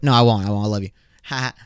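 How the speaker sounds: ordinary talk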